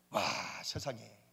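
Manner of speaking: ordinary talk, nothing marked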